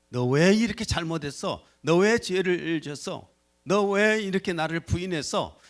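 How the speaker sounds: loud, rising scold; mock scolding. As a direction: hammer each 왜 like a reproach. Imagined rebuke Jesus might give.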